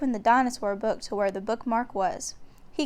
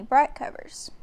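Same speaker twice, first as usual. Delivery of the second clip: usual speaking voice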